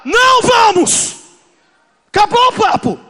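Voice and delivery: high, loud and slow shout; preacher roaring the debate shut; shouted refusal. Acted. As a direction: slam the door on the argument.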